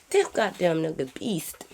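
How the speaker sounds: low, slow disgusted mutter; low exasperated grumble. Real, not acted. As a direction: grit it out, fed up. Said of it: "I'm getting so mad".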